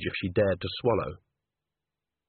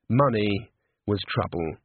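ordinary delivery

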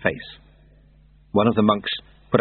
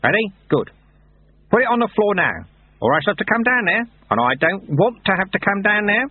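high-pitched taskmaster giving orders; bossy Lutze; 12 semitones higher than usual. Acted. Lutze warning workers from the balcony, bossy. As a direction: order the workers about in a higher character voice.

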